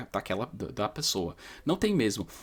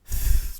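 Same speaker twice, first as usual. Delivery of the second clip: unvoiced th. Shown as a demonstration. Teacher demonstrating unvoiced th. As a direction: air through the teeth, no voice. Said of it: "simplesmente s".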